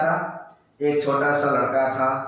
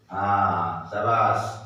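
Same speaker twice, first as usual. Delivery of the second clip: normal talking voice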